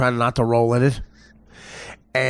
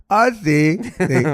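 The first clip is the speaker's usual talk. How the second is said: a light mock Spanish accent, a touch higher; 5 semitones higher, much slower than usual. The Cancun maid answering him, in a light mock Spanish accent.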